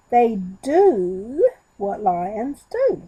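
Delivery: very slow, every word placed; great deliberation. Acted. Monkey lecturing the goat, very slow. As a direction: spell out the second rule slowly. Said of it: "spoke with great deliberation".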